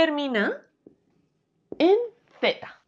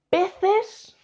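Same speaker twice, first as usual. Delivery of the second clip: "Peces" agudo, subiendo al final; pregunta de prueba; 9 semitones higher than usual. Shown as a demonstration. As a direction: di "Peces" como duda, con tono alto. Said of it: "¿Yo qué digo?". ("Peces" en tono de pregunta, muy ascendente)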